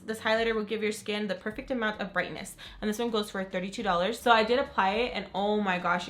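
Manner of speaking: ordinary talk, nothing marked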